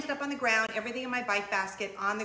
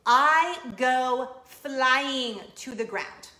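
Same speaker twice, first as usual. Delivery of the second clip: drawn out, louder, each word stretched; dramatic slow emphasis; 7 dB louder, much slower than usual. Retold or imitated. Dramatic retelling of bike crash.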